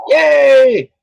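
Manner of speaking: high loud cheer; excited cheer. Real, not acted. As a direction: cheer with relief. Survived the bear fight.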